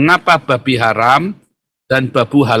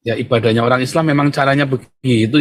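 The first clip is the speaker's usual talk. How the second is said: normal talking voice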